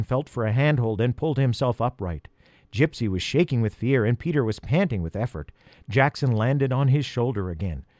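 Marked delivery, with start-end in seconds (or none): none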